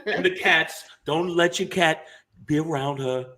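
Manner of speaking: a hint of a ranting bigot; mock bigot. Mocking a bigoted neighbor blaming others, lightly voiced.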